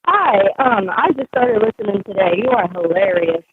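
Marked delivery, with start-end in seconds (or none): none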